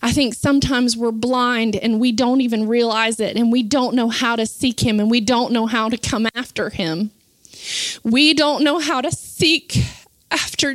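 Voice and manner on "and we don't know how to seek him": ordinary talk, nothing marked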